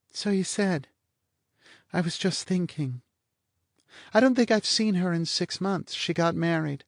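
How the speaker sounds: high, languid teasing woman; bored blonde. A narrator's reading. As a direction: toss it off, lazily mocking.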